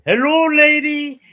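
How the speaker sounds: a high, slow, drawn-out giant's greeting; Andre's gentle giant greeting. Retold or imitated. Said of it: "where he says".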